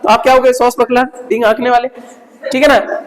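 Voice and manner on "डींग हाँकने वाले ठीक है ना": normal talking voice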